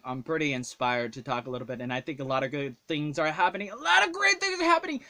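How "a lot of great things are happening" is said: high, loud falsetto exclaim; squeaky high voice. Shown as a demonstration. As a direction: jump up into a silly high voice. Repeats the line in a new voice.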